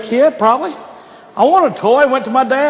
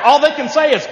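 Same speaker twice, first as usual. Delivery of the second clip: ordinary delivery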